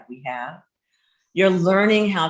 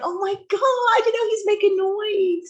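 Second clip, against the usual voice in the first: high, breathless swooning gush; gushing oh my God. Retold or imitated. Wife gushing over her husband's noises, high swoon. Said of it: "I'm like".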